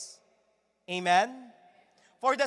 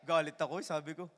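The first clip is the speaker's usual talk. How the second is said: ordinary talk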